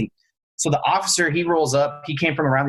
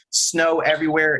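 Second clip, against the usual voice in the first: ordinary delivery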